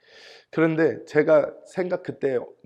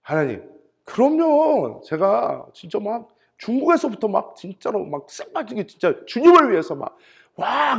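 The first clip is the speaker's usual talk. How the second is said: indignant self-defence; 5 semitones higher, 4 dB louder than usual. Retold or imitated. Pastor defending his hard work to God, worked-up protest. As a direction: plead your own merit with rising emphasis. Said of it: "그래서 제가".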